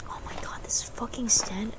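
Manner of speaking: hushed unvoiced whisper. Real, not acted. Gamer whispering at something creepy. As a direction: breathe it out under your breath.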